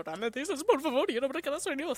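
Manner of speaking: high, fast put-on voice in nonsense Spanish; quoting the bit in a high rushed voice; mock Miku voice. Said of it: "That's what I was imagining".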